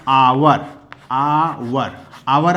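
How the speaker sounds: आवर said slowly with no h sound; आवर with a silent h. Shown as a demonstration. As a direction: repeat आवर clearly, starting on the vowel. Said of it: "इसका उच्चारण आवर".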